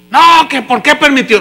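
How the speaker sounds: acted-out protest against God; protesting believer. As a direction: burst in with a complaint. Acting out the believer's angry objection.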